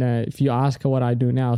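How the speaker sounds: ordinary delivery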